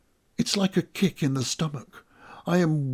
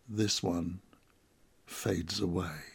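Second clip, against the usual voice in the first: lower, soft and fading; hushed; 6 semitones lower, 8 dB quieter than usual. A narrator's reading. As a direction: let the voice fade away. Narration drops to hush.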